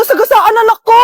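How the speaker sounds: high, loud panicked outcry; shocked cry. Acted. Mother hears on the phone that her child was hit, high and loud.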